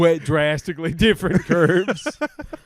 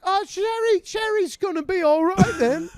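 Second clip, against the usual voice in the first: high mum voice, breezy; mom's voice; 13 semitones higher than usual. Retold or imitated. Mum dismissing daughter's puking, high and breezy. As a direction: brush it off like an unbothered mum. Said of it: "she's like".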